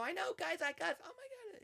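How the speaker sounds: very high-pitched giddy squeal; mock-hysterical, way up high; excited mock scream. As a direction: squeal back to a friend in shared disbelief. Acted mutual screaming with friend.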